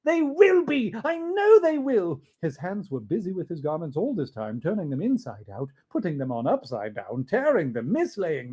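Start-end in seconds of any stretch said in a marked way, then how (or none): 0.0-2.2 high and loud, tumbling joy; overjoyed Scrooge. Acted. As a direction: bubble over with relief.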